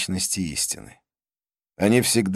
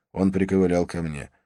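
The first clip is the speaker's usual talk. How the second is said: plain reading voice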